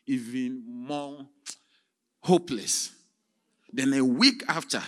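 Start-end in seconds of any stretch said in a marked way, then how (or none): none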